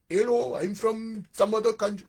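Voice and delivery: hammed-up, not very convincing German accent; weak German accent. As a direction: put on a shaky attempt at a German accent. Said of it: "That's my weak Germany accent".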